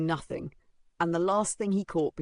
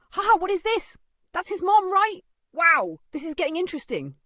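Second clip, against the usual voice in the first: put-on high, loud girlish voices, amused; giggling gossips; 8 dB louder than usual. A narrator's reading. Gossiping girls watch the mother arrive, amused. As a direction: gossip with gleeful glee. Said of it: "giggling among themselves".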